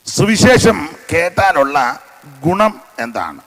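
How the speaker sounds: loud, slow preaching voice. Acted. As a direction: proclaim each question to the congregation. Preacher's rising questions. (ഉച്ചത്തിലുള്ള പ്രസംഗം)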